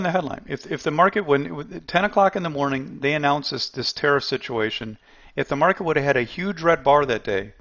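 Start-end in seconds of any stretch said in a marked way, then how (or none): none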